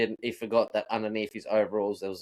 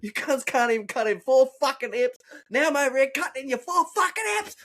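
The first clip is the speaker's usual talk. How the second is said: replaying an angry tirade, raised high; retold rant; 13 semitones higher than usual. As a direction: yell at the useless workmates. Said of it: "and I lost my shit".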